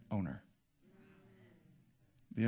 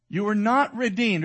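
loud, high proclamation of scripture; emphatic preaching; 7 semitones higher, 7 dB louder than usual. Real, not acted. Preacher reading scripture to the congregation, emphatic and loud. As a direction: proclaim it to the whole room.

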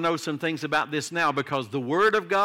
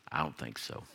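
put-on much lower, much softer old-man brush-off; teasing dismissal, low and soft; typical old man answer; 11 dB quieter than usual. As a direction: drop way down and wave it off like a grumpy grandpa. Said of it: "like a typical old man answer".

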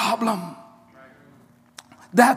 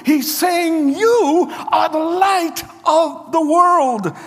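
higher, louder, ringing sermon shout; full-force preaching with a rising shout; 6 semitones higher, 4 dB louder than usual. Acted. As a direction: preach it out to the back row, building.

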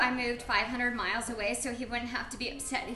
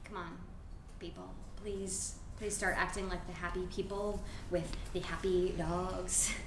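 much softer, begging quietly; weary hushed plea to stop fighting; soft pleading; 4 semitones lower, 8 dB quieter than usual. Acted. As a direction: plead with them under your breath. Guest pleading with couple.

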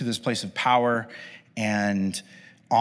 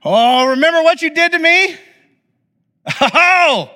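much higher and louder, hammed-up gloating villain; vengeful villain; 8 semitones higher, 5 dB louder than usual. Joseph imagined taking revenge on brothers, hammed-up villain gloat. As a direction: play a cartoon revenge villain. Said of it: "I would think that the next line would be like".

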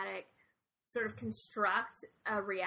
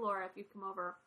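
ordinary talk

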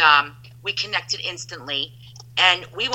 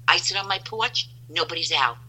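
ordinary talk

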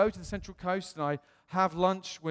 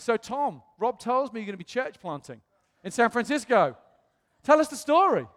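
mock-eager host, higher pitched and louder at the end; retold invitation, building to a high, loud prompt; 6 semitones higher, 7 dB louder than usual.

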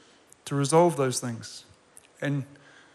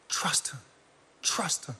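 breathy whisper of "Trust him."; 9 dB quieter, slower than usual. Real, not acted. Preacher whispering to the congregation, urging trust. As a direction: whisper it like a secret.

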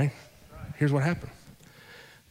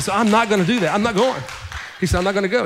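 emphatic refusal; 6 semitones higher, 4 dB louder, faster than usual. Retold or imitated. Husband refusing, voiced loudly.

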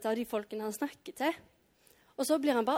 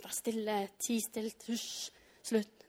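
hushing; 6 dB quieter, slower than usual. Acted. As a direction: imitate a crowd shushing someone. Acts crowd hushing the beggar.

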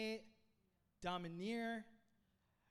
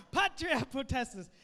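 put-on loud, higher Roman accent; 4 semitones higher, 11 dB louder than usual. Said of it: "say it in a Roman accent".

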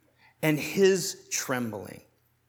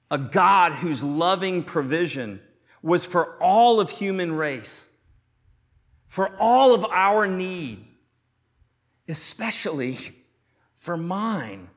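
preaching at full force; 5 dB louder than usual. Acted. Preaching builds to emphatic climax.

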